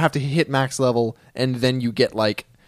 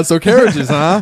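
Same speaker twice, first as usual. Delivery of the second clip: higher, louder mock of the line; mocking imitation; 4 semitones higher, 8 dB louder, slower than usual. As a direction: echo it in a teasing voice. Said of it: "So, uh".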